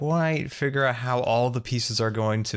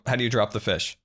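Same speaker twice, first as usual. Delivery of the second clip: ordinary delivery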